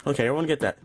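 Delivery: usual speaking voice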